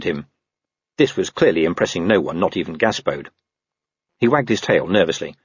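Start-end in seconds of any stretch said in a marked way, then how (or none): none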